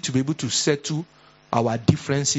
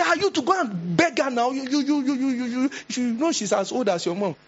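much higher, stuttering you you you; exasperated neighbour; 10 semitones higher than usual. Retold or imitated. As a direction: scold the young one sharply. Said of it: "You say".

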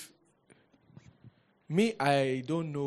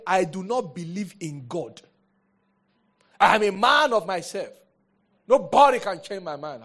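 defiant unbeliever; 4 semitones higher, 9 dB louder than usual. Retold or imitated. Mocking a proud unbeliever.

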